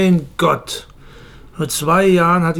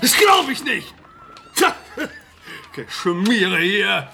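furious rant; 6 semitones higher than usual. Acted. Acting out how Weinert would rage at the news. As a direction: spit it out like a betrayed coach.

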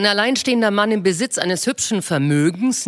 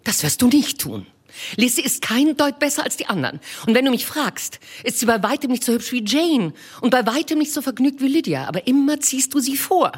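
Mrs Bennet voiced high and bright-eyed, fussing; a high, eager matron gushing to her husband; eager wife; 8 semitones higher than usual. Wife to her husband with news, eager high voice.

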